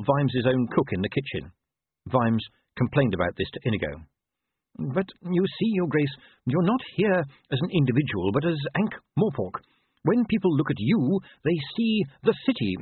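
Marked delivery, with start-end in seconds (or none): 4.8-12.8 a lightly voiced, higher, polite servant explaining; a hint of a proper manservant instructing his master; patient butler. Butler lectures Vimes.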